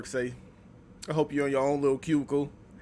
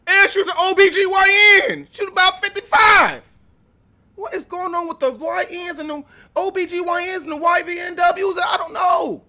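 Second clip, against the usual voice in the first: high, loud, amazed outburst; excited shouting; 12 semitones higher, 6 dB louder than usual. Real, not acted. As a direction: shout in disbelief. Shocked shouting reaction to video.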